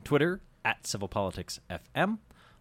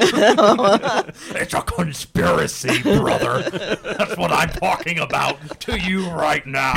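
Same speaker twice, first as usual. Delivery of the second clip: slow, loud wrestler growl; wrestling promo; 5 semitones higher, 9 dB louder, much slower than usual. Pretend. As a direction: rasp it out like a pro wrestler. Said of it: "You need to do the wrestler voice".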